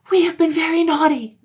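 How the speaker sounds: put-on high, loud child voice crying out; high childish cry; crying children. A narrator's reading. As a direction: call out eagerly as guilty little children. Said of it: "they cried".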